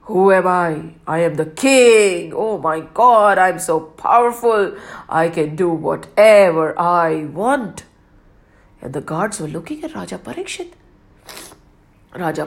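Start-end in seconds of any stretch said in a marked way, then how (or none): 0.1-7.8 loud, boastful king proclaiming power; arrogant king. Acted. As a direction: puff up like a proud tyrant. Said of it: "He was like".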